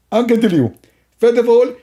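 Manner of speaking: high, lisping character voice; comic opponent. Acted. Opponent announcing a new product, high lisping voice. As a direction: pitch it up into an eager, silly lisp. Acted opponent starts explaining.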